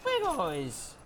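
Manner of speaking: very high squeaky call; squeaky greeting. Real, not acted. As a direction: squeal hello at the dogs. Greeting dogs.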